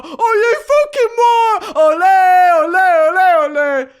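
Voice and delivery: loud, high mock-British bellow; football-chant yell in bad Cockney; drunken yelling. Drunk woman yelling at the bartender, rowdy British shout. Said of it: "I'm yelling at the bartender".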